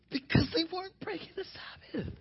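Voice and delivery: very high, soft, squeaky-light delivery; high, hushed answer. Real, not acted. Preacher answering his own "How?", high and hushed. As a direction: lift it right up and keep it small.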